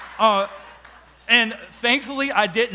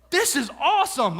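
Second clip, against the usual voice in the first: a bright burst of excitement; excited; 5 semitones higher than usual. Retold or imitated. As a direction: gush with delight at the news. Delighted reaction to news.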